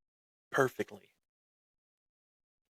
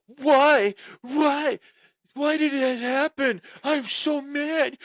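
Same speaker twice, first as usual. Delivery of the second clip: high, hammed-up whining wail, much higher and louder; mock crying whine; 14 semitones higher, 6 dB louder, slower than usual. Imitating wrestling partner whining backstage after his loss. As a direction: whine like a sore loser who lost. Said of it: "He's like".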